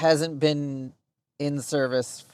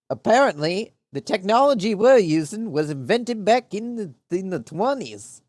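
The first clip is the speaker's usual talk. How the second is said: higher-pitched, badly faked Russian accent; terrible Russian accent; 6 semitones higher, 4 dB louder than usual. Real, not acted. Streamer griping about outdated technology, slipping into a terrible Russian accent. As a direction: lay on a bad Russian accent, pitch swinging up. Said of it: "my terrible Russian accent came out there".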